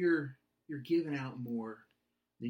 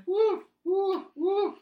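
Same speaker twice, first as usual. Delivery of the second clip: dog barking; 8 dB louder, much slower than usual. Retold or imitated. The dog barks again.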